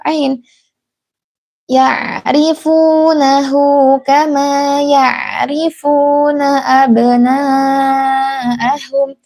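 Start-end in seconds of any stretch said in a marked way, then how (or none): 1.7-9.1 ya'rifunahu with a throaty ain, not a glottal hamzah; correct ain. Shown as a demonstration. Teacher showing the mothers the correct ain, slow and melodic. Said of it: "tapi dibaca seperti huruf ain".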